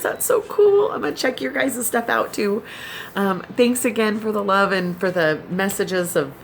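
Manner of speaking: usual speaking voice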